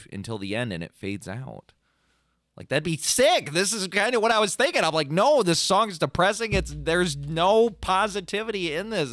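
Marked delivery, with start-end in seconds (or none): 5.1-9.1 higher and louder, insisting; emphatic retelling. Retold or imitated. Arguing with his dad that the song is depressing, emphatic. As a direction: drive the point home.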